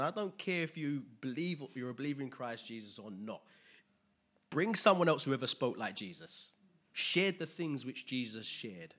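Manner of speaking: normal talking voice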